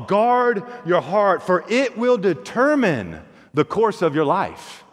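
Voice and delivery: slow, grave reading of Guard your heart; solemn scripture reading. Shown as a demonstration. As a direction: lay each word down slowly like scripture. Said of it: "above all else".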